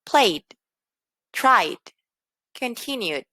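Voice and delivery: "Played, tried, continued" each closed by a small D; small final D. Shown as a demonstration. Teacher listing vowel-ending verbs, small final D. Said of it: "una pequeña D al final".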